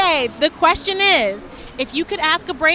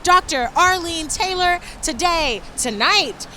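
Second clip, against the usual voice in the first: ordinary talk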